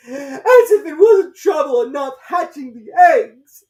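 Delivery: very high, loud, slow and tearful bird voice; distressed pigeon. A narrator's reading. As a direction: wail like a harried mother bird. Pigeon complains, crying and shouting.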